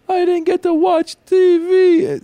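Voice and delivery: very high, loud, slow whine; whiny kid. Retold or imitated. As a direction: whine like a kid whose TV got switched off. Dad voices son whining about TV.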